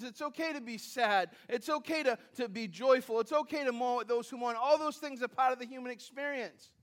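ordinary delivery

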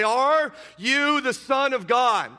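loud, high, slow challenge; demanding question. Acted. Council demanding an answer, loud and slow. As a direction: challenge him like the accusers.

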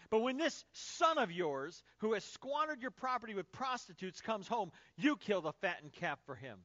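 loud, bitter accusation; angry resentment. Acted. Elder brother accusing father over the younger son, angry. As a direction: hammer the complaint, hard stress on key words. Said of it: "hear the anger in his voice".

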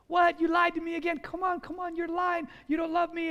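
high-pitched whiny complaint; nagging on and on. Acted. Delilah accuses Samson of lying again, nagging. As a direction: keep on at him without letup. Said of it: "she's going on and on and on".

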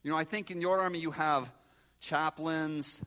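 normal talking voice

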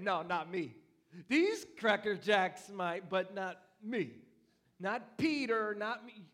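loud, slow, chest-out boast; proud defiant brag, stretching the words out; boastful Peter. Acted. Peter boasting to Jesus he won't leave, loud proud brag.

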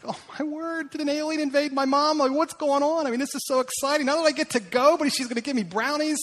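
put-on high, thrilled boy's voice; excited kid. Boy marveling at his mom's surprise yes, high and excited. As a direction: gush like a kid who can't believe it.

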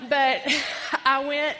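laughing as she speaks; words spilling out through a real laugh; amused laugh breaking into the line. Real, not acted. Laughing at her own joke.

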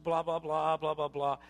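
monotone run of blahs; empty babble. Acted. Preacher mocking irreverent chatter, monotone babble. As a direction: rattle it off as meaningless chatter.

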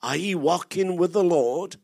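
loud, earnest Scottish brogue, repeating the question. Retold or imitated. Old Scottish elder questions the visiting preacher, loud Scottish brogue. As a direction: press him twice in a thick Scottish brogue. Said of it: "in his Scottish brogue".

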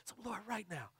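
hushed, solemn plea; preacher's prayer voice. Retold or imitated. Preacher asking the Lord's help in mud, hushed plea. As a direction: pray very softly, like a preacher. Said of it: "talked in my preacher voice like that".

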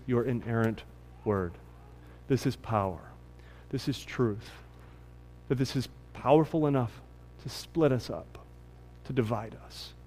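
soft, hushed prayer voice. Acted. Pastor prays for the congregation before preaching, hushed. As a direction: pray quietly over the word.

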